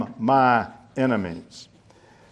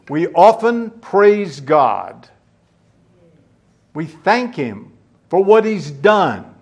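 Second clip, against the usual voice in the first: ordinary delivery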